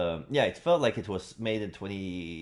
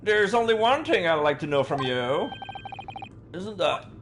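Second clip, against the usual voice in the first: Susato voice; 9 semitones higher, 5 dB louder than usual. Acted. Voicing Susato, mistaken as Sherlock.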